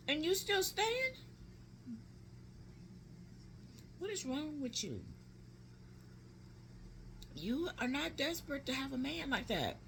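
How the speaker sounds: high-pitched incredulous scolding; exasperated scolding. Retold or imitated. Aunt retelling how she scolded her niece for staying, exasperated. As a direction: retell it as a disbelieving telling-off.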